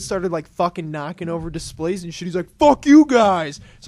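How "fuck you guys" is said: loud, high, drawn-out mock yell; imitating an angry kid shouting; retold tantrum. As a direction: yell it slowly like a defiant kid. Acting out the kid's tantrum.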